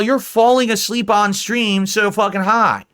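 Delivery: normal talking voice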